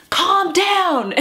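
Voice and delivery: high-pitched, exasperated mock shout; mock yell. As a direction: imitate someone yelling in a most uncalm voice. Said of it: "someone who has yelled".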